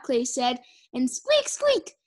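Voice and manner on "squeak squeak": very high, squeaky creature noises; scavenger squeaks. Pretend. Clay plays the winning scavenger, high squeaks. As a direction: squeak like a tiny pest.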